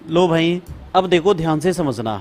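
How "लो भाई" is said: ordinary delivery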